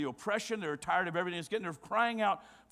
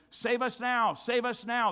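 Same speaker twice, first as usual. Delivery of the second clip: higher, louder pleading call of the crowd; crowd's cry; 5 semitones higher, 5 dB louder than usual. Retold or imitated. Preacher voices the crowd begging for rescue, pleading call. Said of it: "it would have been".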